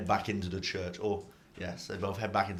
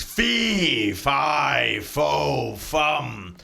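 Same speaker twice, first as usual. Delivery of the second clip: giant's roar; 3 semitones higher, 5 dB louder, much slower than usual. Acted. GM voices the Etin roaring fee fi fo fum. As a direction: bellow it like a fairy-tale giant. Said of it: "stands up and says".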